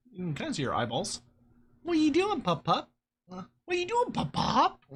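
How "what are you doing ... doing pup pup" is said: high, sing-song puppy talk. Real, not acted. Calling the pup pup in high baby talk. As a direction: coo up at the pup.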